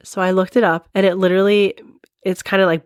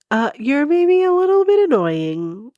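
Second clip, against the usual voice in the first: mock husband complaint; 8 semitones higher than usual. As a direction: tease in a put-on high voice. Imitating husband's complaint about her.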